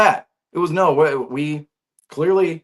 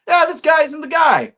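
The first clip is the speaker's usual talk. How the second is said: mock shout of a know-it-all fan, much higher and louder; a higher, louder mock fan yell; mocking couch fans; 5 dB louder than usual. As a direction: sneer it out like a fan yelling at the TV.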